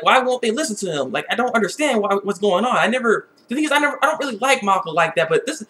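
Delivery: ordinary delivery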